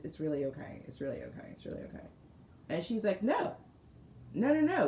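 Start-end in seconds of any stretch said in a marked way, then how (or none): 0.0-2.1 each repeat softer and lower, ending in a whisper; fading out. Retold or imitated. Modest donor brushing off the praise, fading to a whisper. 3.2-4.9 eager insisting. Retold or imitated. Student volunteer insisting they must thank him, eager.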